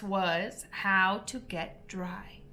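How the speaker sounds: ordinary delivery, nothing marked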